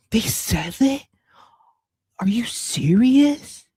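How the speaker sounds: slow, breathy whisper of disbelief; whispered mock shock. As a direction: gasp it out in a hush.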